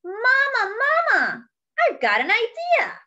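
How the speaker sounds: high, loud call for Mama; little mouse calling Mama, thrilled; calling out excitedly. Acted. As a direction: shout across the house with a new idea. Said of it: "and called out".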